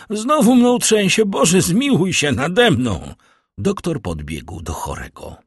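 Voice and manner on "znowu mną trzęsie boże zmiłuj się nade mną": loud, high-pitched panicked wail; Barua crying out. A narrator's reading. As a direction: plead for mercy as a fit seizes you. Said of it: "wykrzyknął Barua". (głośny, wysoki krzyk przerażenia)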